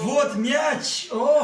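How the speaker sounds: loud, high, delighted cry; excited shout. Real, not acted. Handed a ball, cries out.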